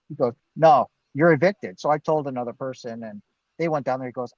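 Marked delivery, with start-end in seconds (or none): 0.6-1.8 curt refusal. Retold or imitated. Office clerk refusing him.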